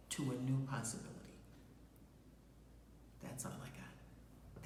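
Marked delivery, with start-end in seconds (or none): 3.2-4.6 whispered sign-off. Real, not acted. Sign-off, voice drops away.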